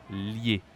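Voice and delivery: 'Lier' as one syllable, lyay; careful French 'Lier'; pronunciation demo. Shown as a demonstration. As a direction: model 'Lier' alone, silent R. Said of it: "lier is more difficult".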